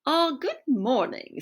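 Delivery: a touch of receptionist formality. Acted. Museum voice answering Herbie's call, slow and a bit formal. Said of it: "sounding slightly more formal now".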